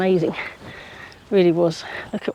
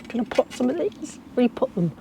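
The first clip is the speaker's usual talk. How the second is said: ordinary delivery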